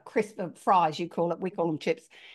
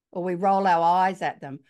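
usual speaking voice

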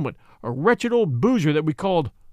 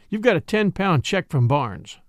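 ordinary delivery

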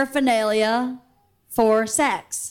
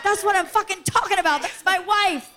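excited; 7 semitones higher than usual. Retold or imitated. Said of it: "I think Bert was like".

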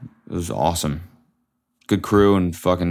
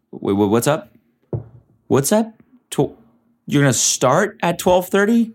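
high, stunned disbelief; shocked disbelief; 9 semitones higher than usual. Retold or imitated. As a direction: voice shooting up in shock. Shocked reaction to late set.

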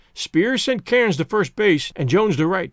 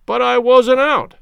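loud, high and slow objection; indignant protest; 5 semitones higher, 7 dB louder, slower than usual. A narrator's reading. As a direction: insist hard you were safe. Captain argues with umpire.

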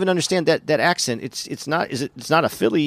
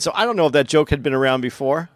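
ordinary delivery

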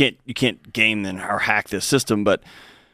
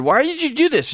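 high, baffled question; puzzled question; 14 semitones higher than usual. Retold or imitated. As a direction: ask it in real disbelief, pitched way up. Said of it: "And I said".

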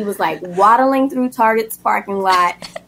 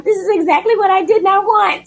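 high, put-on exasperated whine; a higher put-on voice, fed up; 10 semitones higher than usual. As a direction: whine it high in mock dismay. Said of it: "I was like".

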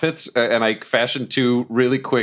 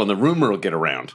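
ordinary talk, nothing marked

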